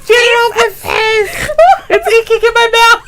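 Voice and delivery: very high-pitched comic panic; imitating someone frantic and grossed out; mock panicked cry. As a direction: squeal it in hammed-up alarm. Acts out panicked movie quote.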